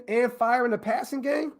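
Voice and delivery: ordinary delivery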